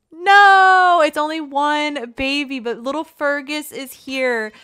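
high, loud and delighted; excited shout. Real, not acted. Greeting the newborn Fergus with a loud, high cheer. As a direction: blurt out the news with a thrill. Reacting to the one-baby result.

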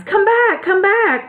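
high-pitched call after fleeing mice. A narrator's reading. Woodman calls to the scattering mice, calling out. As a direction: call them back, pitched well up. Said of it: "while he called to the mice".

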